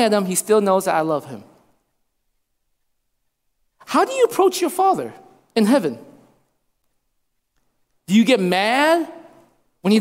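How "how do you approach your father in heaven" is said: ordinary delivery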